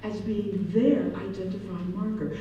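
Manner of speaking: ordinary talk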